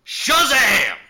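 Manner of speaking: huge, high-pitched battle cry; transformation shout. Retold or imitated. Cowboy announcing his transformation, in a full-volume shout. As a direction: belt it out like a superhero transforming. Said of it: "and I shout".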